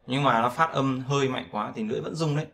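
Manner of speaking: ordinary delivery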